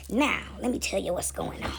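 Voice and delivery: ordinary delivery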